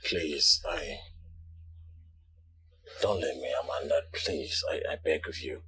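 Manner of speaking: low, hushed begging; desperate plea. Acted. Husband begs his wife not to leave, low and hushed. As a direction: beg her in a hushed, low voice.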